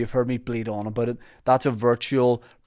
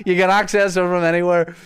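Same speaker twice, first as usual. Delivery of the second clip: stage actor voice; 6 semitones higher, 6 dB louder than usual. Pretend. Still in actor voice.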